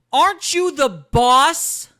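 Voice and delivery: slow, loud, high-pitched outburst; exasperated shout. Real, not acted. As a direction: yell it in disbelief, stretching the words. Host rants at the FBI head.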